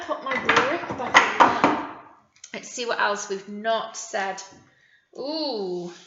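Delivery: normal talking voice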